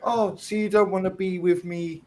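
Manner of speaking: high-pitched mock-hurt partner; hammed-up aggrieved complaint, imitating a partner; imitated aggrieved girlfriend. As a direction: put on a high, wounded girlfriend voice. Voicing his partner's hurt complaint.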